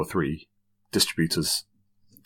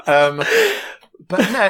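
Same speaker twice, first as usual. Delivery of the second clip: laughing; 4 dB louder than usual. Real, not acted. Laughs at business question.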